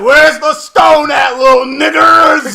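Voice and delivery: slow, loud, high villain shout; shouting Thanos. Retold or imitated. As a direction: bark it like a bully villain. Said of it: "He's like".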